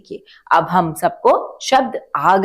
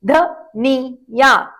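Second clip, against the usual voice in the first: each syllable stretched slowly, short i kept short; drawn out; 5 semitones higher, much slower than usual. Shown as a demonstration. Teacher to children, slow syllable-by-syllable demo. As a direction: spell it out slowly for kids. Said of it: "तभी छोटी ध्वनि निकालें".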